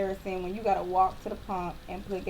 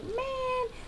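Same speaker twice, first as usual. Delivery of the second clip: exasperated; 4 dB quieter than usual. Retold or imitated. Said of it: "And I was like".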